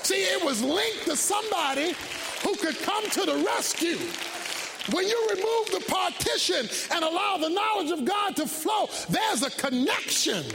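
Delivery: high, driving sermon delivery; preaching at full force. Real, not acted. Preacher telling congregation about connection with God, full-force preaching.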